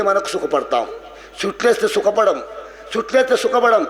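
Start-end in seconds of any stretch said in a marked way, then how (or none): none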